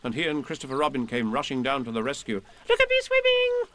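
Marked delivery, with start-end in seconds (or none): 2.7-3.8 much higher squeak, then a giggle; squeaky Roo. Acted. Baby Roo showing off his swimming, squeaky. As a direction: squeak with glee like a tiny joey. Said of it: "squeaked Roo".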